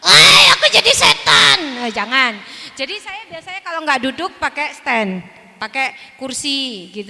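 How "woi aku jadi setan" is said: high, loud mock ghost voice. Teacher playing a hanging puppet as a ghost, high and loud. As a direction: boom it out like a playful spook. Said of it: "kok setan lah".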